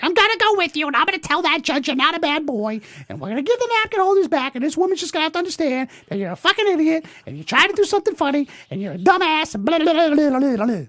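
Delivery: imitating mother. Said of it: "my mother's like".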